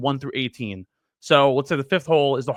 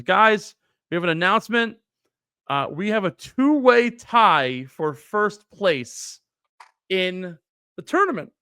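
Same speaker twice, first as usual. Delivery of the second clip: slow, raised announcing voice; tournament announcement; 3 semitones higher, slower than usual. Retold or imitated. Organizer addressing everyone at the clubhouse, announcing. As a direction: draw it out like a public announcement.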